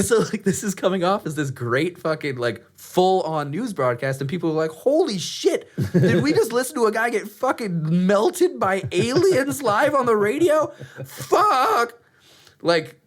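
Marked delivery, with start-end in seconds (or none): 4.7-11.9 panicked. Retold or imitated. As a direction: freak out in disbelief. Panicked listeners voiced.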